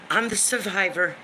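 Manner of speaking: usual speaking voice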